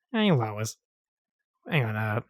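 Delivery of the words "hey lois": rough attempt at a cartoon husband; lightly voiced Boston cartoon dad; Peter Griffin impression. Co-host tries calling his cartoon wife, tentative impression. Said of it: "Do you have a Peter Griffin impersonation?".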